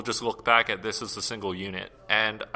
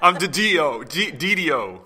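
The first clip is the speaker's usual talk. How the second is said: laughing New York tough guy; New York accent; 5 semitones higher, 6 dB louder, slower than usual. Pretend. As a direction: play a hammy Brooklyn tough. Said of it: "he's from New York".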